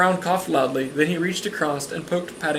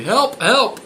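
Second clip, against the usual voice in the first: loud cries for help; 7 semitones higher, 5 dB louder than usual. A narrator's reading. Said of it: "then very loudly".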